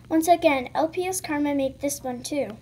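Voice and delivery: ordinary talk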